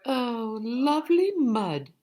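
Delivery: high, loud delighted cow. A narrator's reading. Teacher reading to children as the cow, delighted high voice. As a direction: squeal it like a happy cow wallowing. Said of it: "said the cow".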